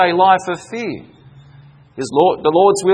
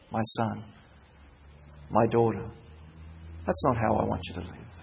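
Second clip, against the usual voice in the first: hushed, much softer, gentle fatherly plea; tender voice of God; 9 semitones lower, 14 dB quieter than usual. Acted. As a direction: speak it softly like God calling a child home. God tenderly addresses his children.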